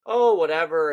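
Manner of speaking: an acted, defensive dismissal; mock dismissive. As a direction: play at shrugging off the blame. Imitating younger self shrugging off blame.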